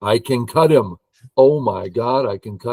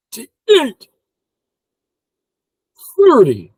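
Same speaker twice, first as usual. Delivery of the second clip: high, loud climax to a count; giddy counting, pitched way up; excited counting; 14 semitones higher, 8 dB louder, slower than usual. Real, not acted. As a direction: shoot the numbers up high and loud. Count climbs to an excited peak.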